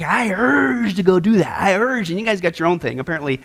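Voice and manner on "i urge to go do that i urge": salivating craving. Pretend. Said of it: "a salivating effect".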